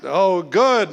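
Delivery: usual speaking voice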